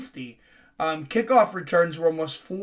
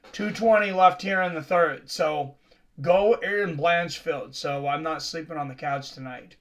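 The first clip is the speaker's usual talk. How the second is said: ordinary talk, nothing marked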